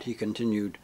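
usual speaking voice

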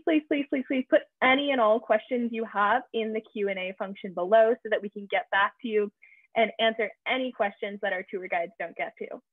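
usual speaking voice

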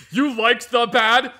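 slow, simple-minded questioning; dim-witted brute. Acted. Dim companion quizzing Virgil, slow and oafish. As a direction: puzzle it out like an oaf.

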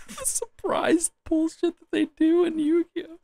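laughing helplessly through the words; high giggling fit. Real, not acted. Giggling fit while reading quiz.

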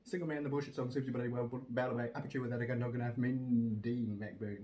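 speed-read then stretch out the end; quick recital slowing at the finish; fast list reading. Shown as a demonstration. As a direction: rattle off the titles quickly. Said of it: "Can you read that font?".

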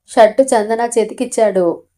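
ordinary delivery, nothing marked

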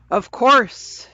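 high, loud, ringing reply; bell-like voice. A narrator's reading. Unseen voice grants the children a break, ringing out bell-like. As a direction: sing out grandly like a bell. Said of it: "said the bell-like voice".

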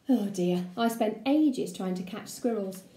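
ordinary delivery

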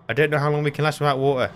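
high, very fast, worried; panicked rush. Real, not acted. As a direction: blurt it in alarm. Taking damage from thirst.

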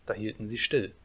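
usual speaking voice

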